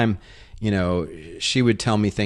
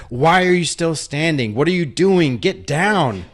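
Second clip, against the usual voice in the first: higher, louder barked orders from a sergeant; sergeant yelling; 9 semitones higher, 5 dB louder than usual. Retold or imitated. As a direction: yell at the soldiers to get down. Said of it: "the sergeant's like".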